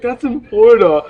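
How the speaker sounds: put-on loud, high protest as the girl; retold exasperated correction; mock indignant retort. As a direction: imitate her loud objection. Girl's angry retort, retold.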